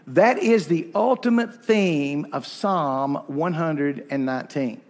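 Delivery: ordinary delivery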